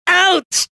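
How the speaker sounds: sharp high ouch; ouch yelp. Acted. Hero struck by an iron ball, high yelp. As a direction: yelp as something smacks you.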